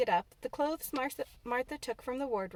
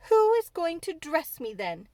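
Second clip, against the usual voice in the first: louder, sharp demand; 6 semitones higher, 7 dB louder than usual. A narrator's reading. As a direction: insist on an answer. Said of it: "demanded Mary".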